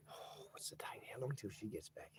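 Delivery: a private whisper while reading the chat; whispered aside. Real, not acted. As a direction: drop to a whisper, thinking aloud. Muttered aside to himself off-mic.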